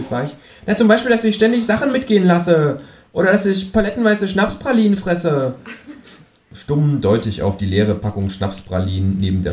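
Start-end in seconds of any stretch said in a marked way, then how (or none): 0.7-5.6 higher indignant kangaroo listing complaints. Acted. Kangaroo complaining to the narrator, higher and offended.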